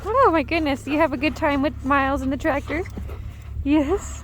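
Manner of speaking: high, sweet voice for the puppy. Real, not acted. Greeting puppy, talking to dog.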